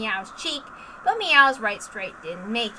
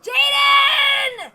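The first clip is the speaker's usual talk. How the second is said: high, loud cry of his name; a straining shout across the sky; battle shout; 14 semitones higher, 8 dB louder than usual. Acted. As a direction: yell it mid-fall, all out. Fight cry, falling through air.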